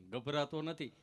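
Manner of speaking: low, halting stammer; imitating a tongue-tied man; stammering friend. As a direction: stick on the words, tongue catching. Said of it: "જીભ જલાય, ચોંટે અટકે".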